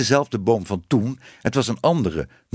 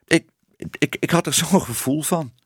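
higher, faltering stammer; hakkelend; 6 semitones higher than usual. A narrator's reading. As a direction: stumble over the first word, embarrassed. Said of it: "Ze hakkelde". (hoog en verlegen hakkelend)

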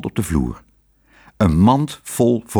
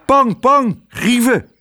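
luid en hoog uitgeroepen, opgetogen; roepend; 4 dB louder, slower than usual. A narrator's reading. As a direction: roep het uitbundig naar de gasten. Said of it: "riep hij". (Pardoes roept opgetogen naar de Franse gasten)

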